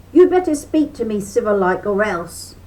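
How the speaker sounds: mildly loud rabbit threat; hollering threat. A narrator's reading. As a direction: threaten him, hot under the collar. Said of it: "he hollered".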